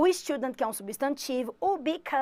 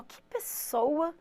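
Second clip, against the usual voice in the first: slow, softer, impressed Que pessoa; admiring tone; 3 semitones higher, 6 dB quieter, much slower than usual. Shown as a demonstration. Teacher shows students a complimentary Que pessoa, slow and soft. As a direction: let Que pessoa sound like praise. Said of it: "essa cara que a teacher fez".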